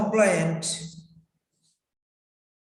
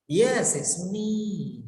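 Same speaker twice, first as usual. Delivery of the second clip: "it's me" with "me" drawn out and falling; "Yes, it's me" gliding down at the end; expressive falling tone; 3 dB quieter, slower than usual. Shown as a demonstration. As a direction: answer with feeling, letting "me" sink. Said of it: "you have to express your feelings".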